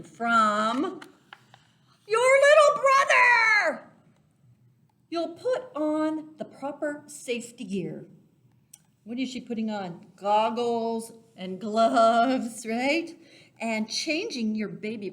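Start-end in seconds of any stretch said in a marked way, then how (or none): 2.1-3.9 high, loud, slow disgusted cry; disgusted shout. Acted. Discovering the stinky diaper. 10.2-13.1 loud, slow excited reveal; slow and loud, savouring each word. Acted. Reader describing the picture.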